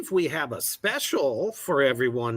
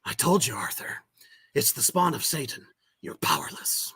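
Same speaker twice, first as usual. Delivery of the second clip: Reaper voice; 6 dB quieter than usual. Acted. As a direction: whisper it like a dark reaper. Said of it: "in your character voice".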